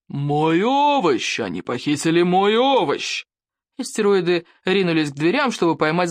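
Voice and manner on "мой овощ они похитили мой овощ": slow, roaring with rage; furious roar. A narrator's reading. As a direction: bellow over the stolen vegetable. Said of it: "бешеный рёв Норберта Сумасброда". (бешеный рёв)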